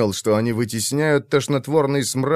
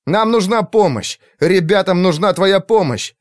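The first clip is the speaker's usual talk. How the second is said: urgent shout; 7 semitones higher, 4 dB louder than usual. A narrator's reading. Will pleading urgently.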